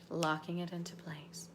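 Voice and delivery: soft, low, whispery meditation voice; guided meditation. Acted. Coach guiding a group through anchoring, soft meditation voice. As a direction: guide them inward gently, almost whispering.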